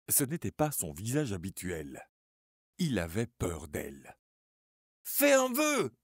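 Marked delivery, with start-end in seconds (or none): 5.0-5.9 much higher, loud shrieked command; deafening scream. Acted. Suzanne screams at Charlie to make a wish, deafening. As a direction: scream it right at him. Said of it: "hurla-t-elle d'une voix assourdissante".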